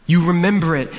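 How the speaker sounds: high, loud desperate cry; terrified plea. A narrator's reading. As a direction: plead in rising terror. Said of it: "a note of terror in her voice".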